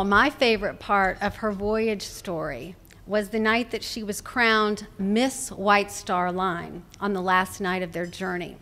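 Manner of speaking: ordinary talk, nothing marked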